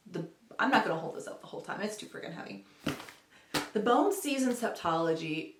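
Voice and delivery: ordinary talk, nothing marked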